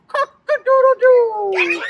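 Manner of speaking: high, loud rooster crow. Pretend. Rooster sound for kids.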